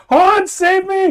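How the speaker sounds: high, loud, drawn-out laughing plea; mock cry for help. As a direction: wail for Hans to save her, half laughing. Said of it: "run over here and go".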